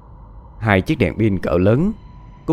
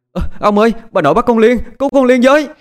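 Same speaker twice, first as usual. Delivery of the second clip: put-on a much higher, much louder child's desperate scream for help; shouting; 13 semitones higher, 5 dB louder, faster than usual. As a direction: scream for the grandfather to save his sister. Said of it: "nó hét lớn". (hét lớn cầu cứu ông)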